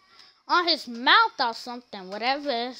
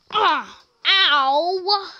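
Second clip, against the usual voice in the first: mock hurt yelps; 4 semitones higher, 6 dB louder than usual. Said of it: "Then Ashton be like this".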